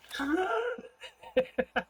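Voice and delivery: squealing high laugh. Real, not acted. Laughing after fight.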